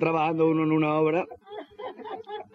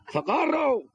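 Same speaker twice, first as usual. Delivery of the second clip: high, strained mock cry for help; 11 semitones higher, much slower than usual. Fallen worker calling up from the hole, high mock yell.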